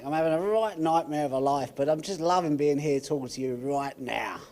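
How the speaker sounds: put-on a lightly done Australian accent with a cheeky start; Aussie accent. [name] jokes about his life in an Aussie accent. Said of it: "in a full Aussie accent".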